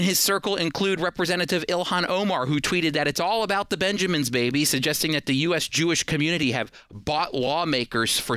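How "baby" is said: usual speaking voice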